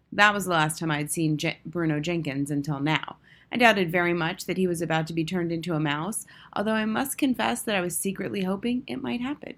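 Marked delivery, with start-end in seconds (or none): none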